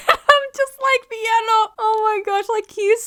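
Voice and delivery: high, loud, squealing laughter through the words; laughing. Real, not acted. Fan cracking up at a character's eager thanks, laughing.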